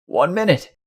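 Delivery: a touch of panic; panicked stammer. A narrator's reading. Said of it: "a panicking coworker stammered".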